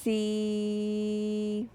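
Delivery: long stretched "See"; drawn-out "See" like a reading teacher; held sound. Shown as a demonstration.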